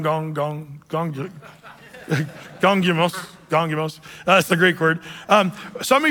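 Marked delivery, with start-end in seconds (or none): 0.0-1.1 mock murmur. Shown as a demonstration. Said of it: "even the Greek word gong".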